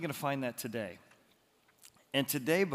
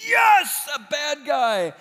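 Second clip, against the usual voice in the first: much higher and louder burst of glee; gleeful yell; 13 semitones higher, 11 dB louder, slower than usual. Acted. Preacher reliving the movie chase, high gleeful yell. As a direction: yelp with delight.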